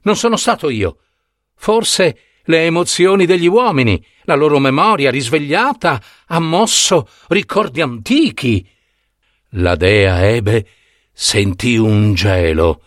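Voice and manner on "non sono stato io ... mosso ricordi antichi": terrified. Acted.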